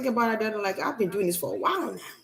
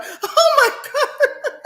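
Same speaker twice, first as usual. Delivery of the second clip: very high, loud, drawn-out mock sob; fake crying on cue; 5 dB louder, much slower than usual. Shown as a demonstration. Showing challengers she could cry on cue, mock crying. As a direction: burst into showy fake tears. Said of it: "I could cry on cue".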